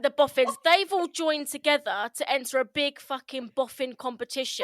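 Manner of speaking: loud, indignant venting; worked-up, punchy complaint; comic angry rant. Real, not acted. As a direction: rant scornfully, playing it for laughs. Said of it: "Angered. So I'll try".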